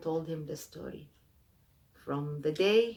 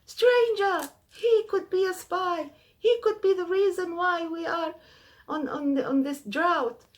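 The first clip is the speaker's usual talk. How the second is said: much higher, louder alarmed yelling; screaming crowd; 13 semitones higher, 7 dB louder than usual. Acted. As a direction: cry out to the square that a spy has come. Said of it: "people screaming".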